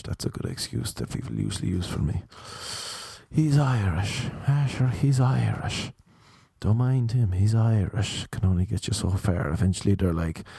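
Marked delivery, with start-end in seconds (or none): 3.3-4.3 mock thick Irish accent, pitched up. Middle woman excusing underdressed guest, Irish accent. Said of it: "she started making up excuses". 4.5-8.3 mock thick Irish accent, pitched up. Middle woman excusing underdressed guest, Irish accent. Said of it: "she started making up excuses".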